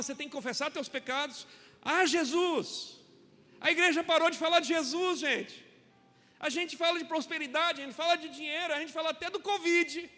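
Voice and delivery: loud, building sermon cry; fiery preaching. Acted. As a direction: preach it out to the congregation. Preaching at full force. (pregação inflamada e forte)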